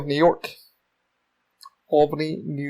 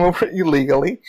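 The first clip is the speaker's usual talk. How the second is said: ordinary delivery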